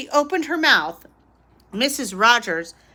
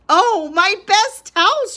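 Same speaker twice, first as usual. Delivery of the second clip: higher, louder dismayed cry; dismayed wail; 9 semitones higher, 5 dB louder than usual. A narrator's reading. Missus Rogers wailing at her ruined best towels. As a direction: wail over the spoiled towels.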